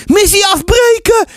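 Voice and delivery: put-on high, excited child's yell; bellowing boy. A narrator's reading. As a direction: call the retreat like an excited boy. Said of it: "brulde Ties".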